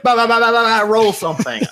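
high, loud hammed-up babble; hammed-up GM spitting out filler; mock rambling GM. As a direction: gabble like a GM rushing a scene. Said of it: "and it's like".

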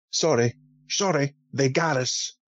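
imitation of an off-putting American accent; Sean Connery's American-sounding delivery. Film fan mimics the odd line said to Indy, American accent. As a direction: put on an American-tinged Connery voice. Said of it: "he turns around to Indy and he goes".

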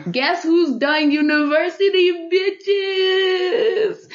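high, sung celebration; excited sing-song. Real, not acted. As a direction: sing out the victory. Celebrating finishing university.

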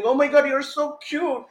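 put-on high, cooing voice of a grown-up fussing over a child; cooing adult. Grown-up cooing over a cute little boy. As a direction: coo it like pinching a kid's cheek. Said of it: "pulling my cheek and saying".

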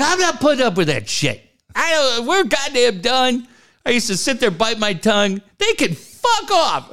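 high-pitched mock tirade; heated. Venting to an ex to make her laugh, heated. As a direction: rant it up high for laughs, building to the last blow. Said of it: "it got me all heated".

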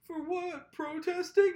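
high, hammed-up weepy protest; mock crying. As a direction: whine it as if in tears, mocking.

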